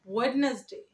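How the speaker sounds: WEDNESDAY as "WED-nes-day", d sounded. Shown as a demonstration. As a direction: say ওয়েডনেসডে in three syllables. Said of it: "এভাবে বলি".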